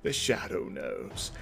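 low, slow, soft ominous whisper; spooky deep voice. Pretend. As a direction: intone it like a radio villain. Spooky aside on shadows.

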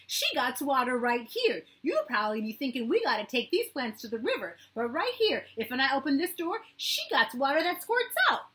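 put-on high, loud thrilled little boy; a child showing off a wonder; excited boy. A narrator's reading. Matt to the blind man, loud thrilled child.

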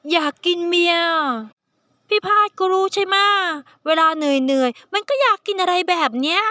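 put-on high, sweet coaxing young man's voice; cute pleading youngster. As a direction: wheedle like a spoiled kitten. Nid the omega coaxes Phad.

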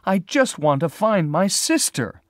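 high, loud, distressed outburst; crying unhappily. Acted. Lila begging the sheriff to search, distressed high cry. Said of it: "Lila cried unhappily".

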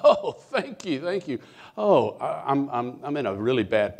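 high, loud, laughing relief; relieved laughing gratitude. Retold or imitated. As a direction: gush thanks with a nervous laugh. Desperate man thanking God.

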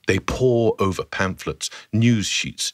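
normal talking voice

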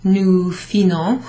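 nous finons said slow as a deliberate wrong guess; wrong form, slow. Shown as a demonstration. Teacher showing students the wrong form, slow. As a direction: drawl out nous finons as the tempting mistake. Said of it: "instead of just putting O N S".

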